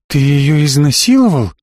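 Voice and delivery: a hint of horror and threat in the voice; dreadful voice. A narrator's reading. Elli confronts Slavik about Margo, menacing. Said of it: "страшным голосом произнесла Элли".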